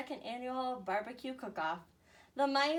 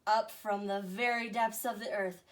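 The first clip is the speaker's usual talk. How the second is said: plain reading voice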